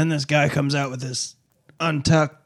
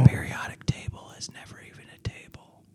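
whispering; 6 dB quieter than usual. Acted. Conspiracy whispers begin.

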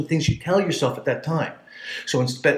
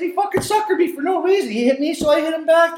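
excited retelling; 3 dB louder than usual. Retold or imitated. His own retold answer.

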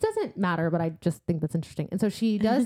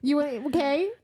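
higher, mock about-to-cry voice; mock near tears; 6 semitones higher than usual. Rhys answering her taunt, mock near tears. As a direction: imitate him trying not to cry. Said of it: "He's, like, about to cry".

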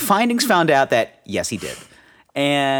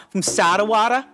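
mock Philly accent; 8 semitones higher than usual. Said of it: "fucking Philly now".

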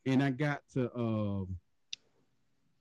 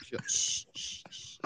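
imitated soft shushes, trailing quieter; shushing; 11 dB quieter, much slower than usual. Nurse rubbing his shoulder, imitated shushing. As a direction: hush gently, as the nurse did. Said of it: "Did she shush you?".

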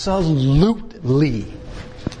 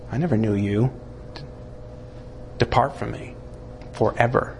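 softer, low, final sentence; hushed Jesus; 6 semitones lower, 6 dB quieter, faster than usual. Acted. Jesus to the terrified before the throne, hushed and low. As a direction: let it fall quiet and heavy. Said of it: "And Jesus says".

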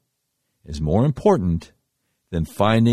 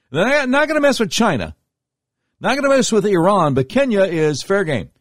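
indignant; 10 semitones higher, 3 dB louder than usual. Real, not acted. Indignant rant peak.

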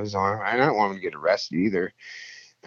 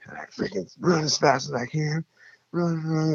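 higher, urgent retelling of running; panicked running; 8 semitones higher than usual. Retold or imitated. Fleeing the chaser, telling a friend, urgent and higher. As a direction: pitch up as if still running for your life.

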